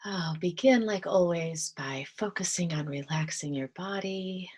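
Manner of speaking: soft, calm meditation voice with whispered stretches; gentle guided meditation, drifting into whispers. Acted. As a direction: ease them into stillness, half-whispering.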